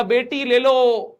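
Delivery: high, loud, slow pleading offer; desperate mock offer. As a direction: beg the victor, drawing out each word. Defeated king offers his daughter.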